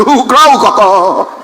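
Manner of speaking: chanted mock stammer, syllable by syllable; class echoing the stammer. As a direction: parrot the teacher's stutter in rhythm. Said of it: "The whole class shouted".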